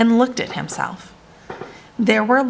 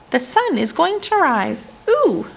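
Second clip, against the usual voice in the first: high piping squirrel voice ending in a squeal; little squirrel piping up with glee; 7 semitones higher than usual. Acted. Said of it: "piped up".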